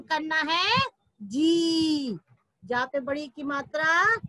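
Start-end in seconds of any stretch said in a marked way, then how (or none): 1.2-2.2 जी with a long, held ee; long ee sound. Shown as a demonstration. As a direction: draw out जी as a model to repeat. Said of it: "उसका उच्चारण". (जी को लंबी ई से खींचकर)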